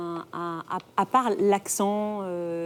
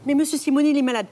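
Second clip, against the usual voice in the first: protesting son, higher pitched; Guy Bedos; 7 semitones higher, faster than usual. Acted. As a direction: plead back at an overbearing mother. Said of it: "Et Guy Bedos lui dit". (fils qui proteste, voix plus aiguë)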